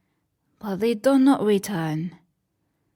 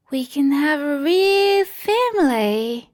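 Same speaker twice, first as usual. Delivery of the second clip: higher, louder joyful cry; delighted; 6 semitones higher, 4 dB louder than usual. Acted. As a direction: burst with happiness. Lost boys delighted.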